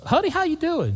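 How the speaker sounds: sweet greeting. Retold or imitated. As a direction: sing out Honey way up high, friendly. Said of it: "he asked the waitress".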